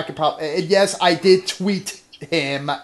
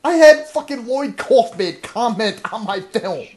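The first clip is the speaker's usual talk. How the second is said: excited; 7 semitones higher, 7 dB louder than usual. Real, not acted. Bragging about celebrity comment.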